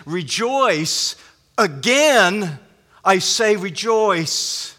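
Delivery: preacher stresses rejoice twice, slowly and firmly; double emphasis. Shown as a demonstration. Paul urging the troubled church, emphatic stress on rejoice. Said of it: "A, a double emphasis, emphatically".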